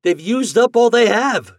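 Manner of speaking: high excited shout to the others. A narrator's reading. Toad to his side in a snowball fight, shouting high. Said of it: "shouted Toad".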